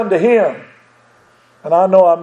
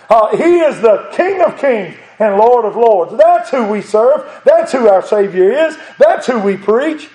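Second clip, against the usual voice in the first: raised, driving sermon voice with bursts of laughter; fervent preaching; 4 semitones higher than usual. Acted. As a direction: preach it out with joy to the congregation. Preaching at full force.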